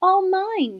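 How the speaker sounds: high, loud crow of triumph; gleeful cry. Acted. Turtle king claims his pond, crying out gleefully. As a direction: claim it all in one burst. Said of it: "Yertle cried".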